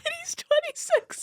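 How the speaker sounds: high-pitched words squeezed through laughter; cracking up. Real, not acted. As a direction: add it while still helpless with laughter. Still cracking up.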